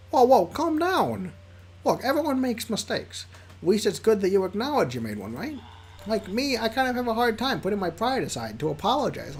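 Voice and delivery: squeaky Liam voice. Acted. Squeaky Liam reassuring the upset player. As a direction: squeak it out as the upbeat friend. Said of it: "the squeaky voice I do for Liam".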